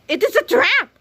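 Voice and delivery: spring the trap with a squeaky villain cry; villain gloat. Acted. Mad scientist springs his trap on the hero, high gleeful reveal.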